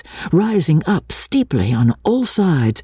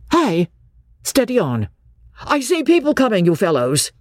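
higher urgent warning to friends; changed, warning call; 7 semitones higher than usual. A narrator's reading. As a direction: call out a warning across the trees. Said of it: "Then his voice changed".

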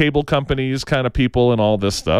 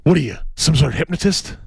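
movie quote; faster than usual. Retold or imitated. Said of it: "he goes".

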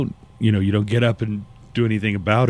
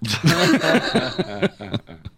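laughing; 7 semitones higher than usual. Real, not acted. Laughing at own impression.

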